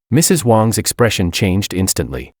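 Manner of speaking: ordinary delivery, nothing marked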